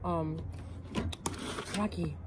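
speaking between chews; words muffled by food; mouth full, chewing. Real, not acted. Seen 0: Bite taken at -1.0; lips closed, chewing while speaking afterward.

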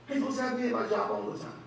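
ordinary delivery

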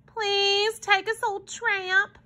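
put-on slow, high-pitched little boy; pleading child. A narrator's reading. Paul begging the tramp to take them, pleading. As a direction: draw out the plea in a child's high voice.

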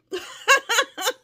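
high, loud peal of laughter; delighted laugh. Real, not acted. Tickled by how cute the glasses look, high laugh. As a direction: burst out in a high giggle.

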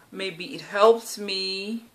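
drawn out slowly, wondering aloud; slow hopeful musing. Real, not acted. Talking to camera about training, slow hopeful musing. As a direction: let it hang, slowly hoping. Hopeful thought, then echoed.

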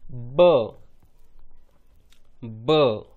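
ब with a short 'uh' vowel, said twice; blended syllable demo. Shown as a demonstration. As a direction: punch out ब, pause, ब. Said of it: "तो बन गया".

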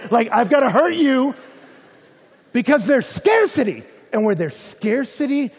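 high, loud preacher push; a full-force sermon build; preaching loud. Real, not acted. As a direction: drive the point home hard. Preaching, driving the point home.

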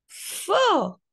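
high little cry of disgust. Acted. Ada catching the stink again, high disgusted fo. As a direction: wrinkle at the smell.

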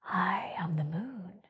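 soft, gentle whispered voice; the moon speaking tenderly, barely voiced; sweet whisper. A narrator's reading. The moon introducing herself to Hare, whispering. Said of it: "said a sweet voice".

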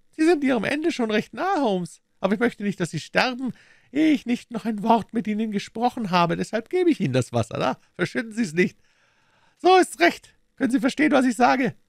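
high, loud, taunting voice; scornful villain Smith. A narrator's reading. Smith berates dying Holmes, scornful high voice.

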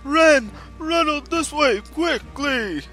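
urgent calling out. Acted. Calling kids to flee.

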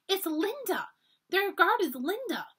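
high, startled cry of recognition; gasping surprise. A narrator's reading. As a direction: blurt it out in shock, slowly. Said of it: "She gasped".

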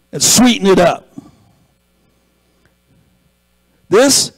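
rising sermon delivery, every line pushed up; preaching. Acted. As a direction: lift it like a pulpit climax. Builds into preaching.

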